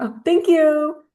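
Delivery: high, loud, slow thanks; pleased gasp of thanks; touched surprise. Real, not acted. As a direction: caught off guard and delighted. Anniversary wishes from a child.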